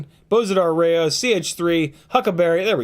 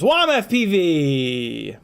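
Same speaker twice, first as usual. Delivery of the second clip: hyped name call; much slower than usual. Real, not acted. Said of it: "Bring my voice down".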